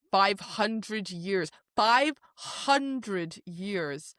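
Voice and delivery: slow, drawn-out five hundred years; dramatic slow emphasis. Real, not acted. Host stressing Jurian's long ordeal, dramatic slow. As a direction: stretch every word for effect.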